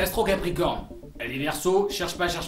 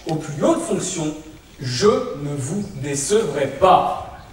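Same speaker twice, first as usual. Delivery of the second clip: lent et solennel, tribun de meeting; discours politique; 3 dB louder, slower than usual. Acted. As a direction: parle aux foules comme un président. (discours de meeting, lent et solennel)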